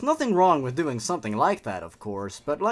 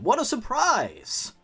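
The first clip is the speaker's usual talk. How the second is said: sarcastic surprise; 9 semitones higher, slower than usual. Real, not acted. Sarcastic review of the story.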